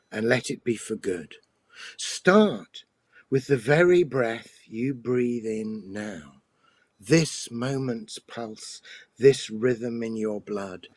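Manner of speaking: slow, measured recital with long pauses; poem reading. Acted. Poet reading his poem The Singing Bowl to the podcast, slow and measured. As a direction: savour each line and let the silences ring.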